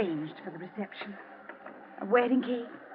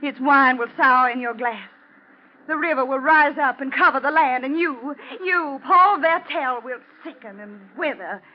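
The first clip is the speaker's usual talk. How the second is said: rising higher and louder, slowing on his name; a prophecy swelling to a cry; building curse; 3 semitones higher, 5 dB louder than usual. Acted. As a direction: pronounce doom on him. Wife pronounces the curse on him.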